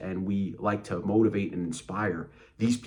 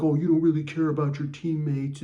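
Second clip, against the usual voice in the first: higher-pitched imitation of a guilt trip; mock guilt-tripping; 8 semitones higher, 4 dB louder than usual. Coach blaming you over your teammates, mock guilt-tripping. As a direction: lay on blame in a mocking voice. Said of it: "try to make you feel guilty".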